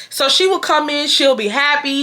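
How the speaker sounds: usual speaking voice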